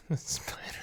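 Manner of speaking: breathless giggling whisper; whispered laugh. Real, not acted. Player reading the quest text, giggling under breath. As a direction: try to say it through suppressed laughter. Cracking up mid-read.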